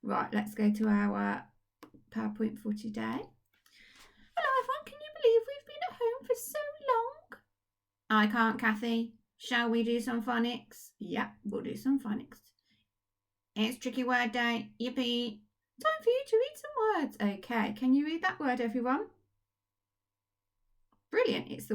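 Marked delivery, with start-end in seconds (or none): 3.0-7.4 very high, squeaky puppet voice full of cheer; a chirpy puppet praising the children; Kathy puppet voice. Acted. To the class, puppet Kathy cheers them on, squeaky and high. 15.8-17.4 very high, squeaky puppet voice full of cheer; a chirpy puppet praising the children; Kathy puppet voice. Acted. To the class, puppet Kathy cheers them on, squeaky and high.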